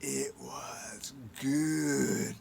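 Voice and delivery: drawn out, low and slow relish; low slow dreamy relish; slow savoring. Acted. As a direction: stretch it out like tasting it again. Raven savoring the memory.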